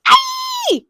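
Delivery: very high shriek of fright; man shrieking at the doll; scared scream. A narrator's reading. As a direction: scream in terror. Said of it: "The man screamed".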